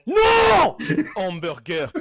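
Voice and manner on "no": loud high shout of protest. Real, not acted. Hearing the wrong word, shouting no in protest. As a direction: yell it out, outraged.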